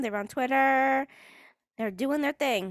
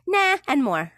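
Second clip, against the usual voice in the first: mock shouted nah; 4 semitones higher, 5 dB louder, slower than usual. Ridiculing an athlete's prayer tweet, half-shouted mock. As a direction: shout it louder, lampooning the prayer.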